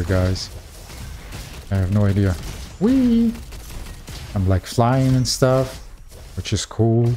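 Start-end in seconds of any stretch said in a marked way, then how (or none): 2.8-3.3 high-pitched "whee"; playful whee. Real, not acted. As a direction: squeal "whee" while flying along. Flying about in the game.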